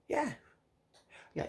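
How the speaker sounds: ordinary delivery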